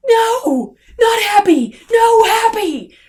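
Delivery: anguished yell. Real, not acted. Reviewer protesting the happy ending music, high yell.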